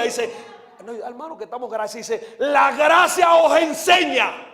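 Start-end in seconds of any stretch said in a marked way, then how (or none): 2.4-4.4 slow, raised preaching shout; versículo a gritos. Real, not acted. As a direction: cry out the scripture, stretching the words. Cita la Biblia a gritos. (grito lento de predicador)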